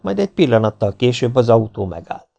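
plain reading voice

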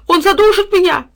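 high, loud panicked yelp; terrified cry. A narrator's reading. Dog being choked crying out in terror. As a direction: scream it in terror. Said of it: "в ужасе воскликнул Тило".

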